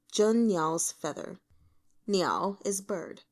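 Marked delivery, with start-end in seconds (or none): none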